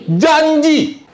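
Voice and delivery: high, loud single word called out; shouted answer. Real, not acted. Preacher calls out the answer loud to the church. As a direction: ring it out to the congregation.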